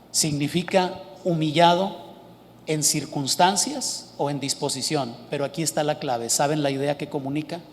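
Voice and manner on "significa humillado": normal talking voice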